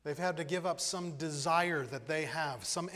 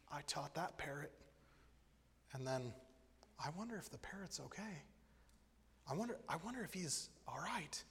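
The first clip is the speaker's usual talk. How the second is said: worried inner voice; 10 dB quieter than usual. Acted. John worried, thinking to himself.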